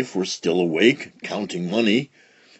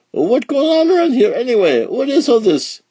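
bewildered; 14 semitones higher, 5 dB louder than usual. A narrator's reading. Mailman bewildered by piles.

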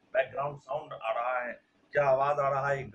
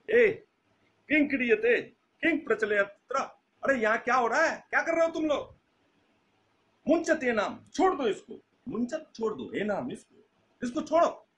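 loud, raised-pitch police scolding; scolding inspector; 7 semitones higher, 7 dB louder than usual. Acted. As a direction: act out an officer sharply ordering them to stop. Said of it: "इसको डांट रहे हैं". (ज़ोर से डांटता हुआ दरोगा)

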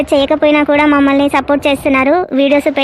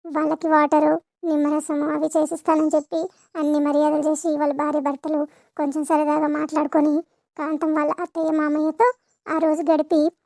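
much softer, even storytelling voice; narrating the episode recap to viewers, softer; story narration; 10 dB quieter than usual. A narrator's reading. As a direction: tell the story quietly to viewers.

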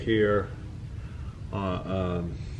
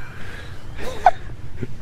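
high wheezing laugh with no voice; breathless silent laugh. Real, not acted. Laughing at own mispronunciation.

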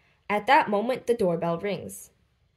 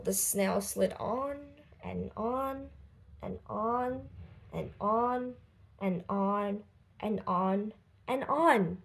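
fading refrain; 6 dB quieter, slower than usual. A narrator's reading. The endless journey fading away.

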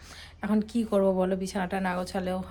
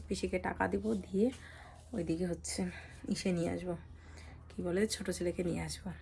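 soft, careful voice, sore throat; hushed, quiet words to save her sore neck; speaking softly from pain; 8 dB quieter than usual. Real, not acted. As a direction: keep it soft so it doesn't hurt. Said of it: "আস্তে আস্তে কথা বলতেছি".

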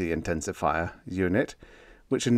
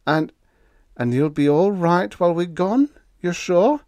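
apprehensive; 9 semitones higher, 5 dB louder than usual. A narrator's reading. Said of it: "Biggs asked apprehensively".

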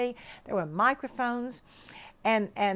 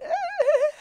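high, louder stunned uh; speechless uh; 7 dB louder than usual. Retold or imitated. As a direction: blurt a lost, tongue-tied uh. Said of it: "And I looked at him and I said".

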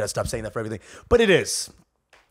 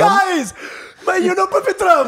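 very high, louder whiny wail; mock wail; 5 dB louder, slower than usual. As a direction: wail it like a tragedy has struck. Mocking the Brahmin guy wailing.

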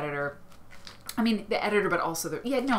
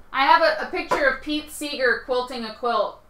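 loud, raised call to someone away; 7 semitones higher, 10 dB louder than usual. Real, not acted. Host calls out to Eric about her picture, calling out. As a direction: call the news out, high and loud.

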